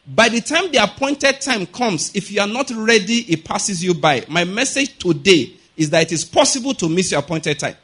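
normal talking voice

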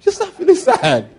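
high, slow, laughing mock sadness; mock sad, laughing. Preacher mocking listeners who choose to feel sad. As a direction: tease the congregation's sad habit.

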